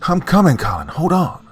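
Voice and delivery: high, loud desperate shout; urgent yell. A narrator's reading. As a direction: yell so he holds on. Johnny yells to his brother.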